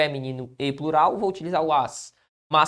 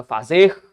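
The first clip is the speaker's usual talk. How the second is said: "Fazer" with the final syllable louder, rising; stress on last syllable; 6 dB louder than usual. Shown as a demonstration. Teacher showing the tonic syllable of "Fazer", stressed ending. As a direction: lean on the "zer" of "Fazer". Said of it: "onde era a sílaba tônica?".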